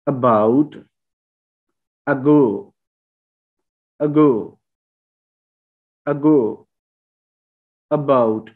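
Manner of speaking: अबाउट and अगो, opening with a short uh; schwa in about. Shown as a demonstration. Teacher drills schwa at the start of words. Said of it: "वो श्वा है".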